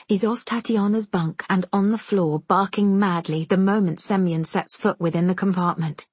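usual speaking voice

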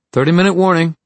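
high loud wake-up call. A narrator's reading. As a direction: call it out across the camp. Wake-up call outside tent.

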